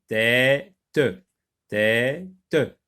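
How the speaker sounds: "tête" broken in two, tê... te; split into syllables. Shown as a demonstration. Teacher locating the sound for pupils, split into syllables. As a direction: say "tête" as two separated beats, tê, te.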